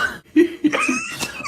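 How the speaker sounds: brief, high, quiet chuckle; laughing. Real, not acted. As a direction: chuckle to yourself after the joke. Laughs after own punchline.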